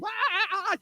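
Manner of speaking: high, soft hyena cackle; hyena laugh. Acted.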